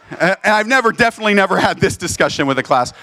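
ordinary talk

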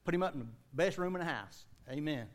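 ordinary talk, nothing marked

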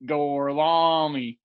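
very slow, drawn-out Italian Gorlami; exaggerated mock Italian. Cohost redoing the Brad Pitt line on request, hammed-up Italian accent. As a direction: stretch Gorlami out like a bad Italian. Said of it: "One more time".

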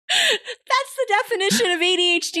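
high-pitched, giddy joke reveal; mock excitement. Host joking about the basic definition, mock excitement. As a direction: squeal it as pretend big news.

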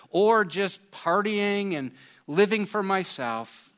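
prayer. Acted. Pastor leading listeners in a repentance prayer, earnest.

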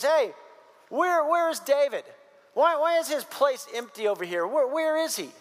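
acted-out puzzled asking; puzzled demand. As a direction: ask like a host missing his guest, pitch up. Acted Saul asking after David.

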